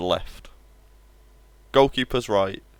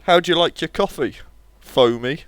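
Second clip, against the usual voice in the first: joke delivery; 6 semitones higher, 7 dB louder than usual. Acted. Telling a joke loudly.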